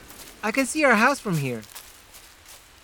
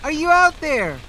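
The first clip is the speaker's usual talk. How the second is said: calling from far away; 8 semitones higher, slower than usual. Acted. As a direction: call a name across the lake. Calling for a missing man.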